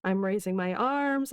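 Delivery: normal talking voice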